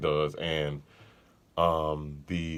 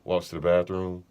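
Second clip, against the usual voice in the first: normal talking voice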